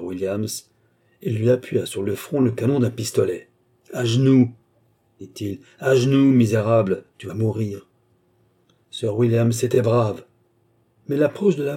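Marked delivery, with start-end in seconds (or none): 3.9-4.5 louder, clipped order to kneel; commanding threat. Acted. Armand holding a pistol to the villain, ordering. 5.8-7.9 louder, clipped order to kneel; commanding threat. Acted. Armand holding a pistol to the villain, ordering.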